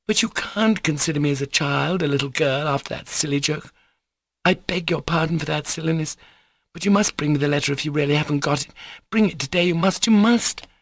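high, pleading urgency; insistent young girl. Acted. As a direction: beg and order at once. Lisa pleads for the letter.

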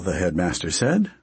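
ordinary delivery

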